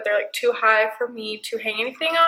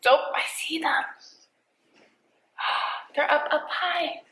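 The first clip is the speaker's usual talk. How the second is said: whisper; 3 semitones higher than usual. Real, not acted. Hushed pointing out birds.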